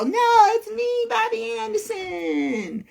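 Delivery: very high, stretched-out mock announcement; Bobby Anderson. Decoy stepping up front to claim identity, hammed-up squeal. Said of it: "Bobby, you go up in front and go".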